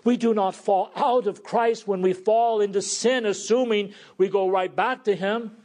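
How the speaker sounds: preaching. Acted. Preacher declaring the gospel to his flock, with full force. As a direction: proclaim it to the congregation.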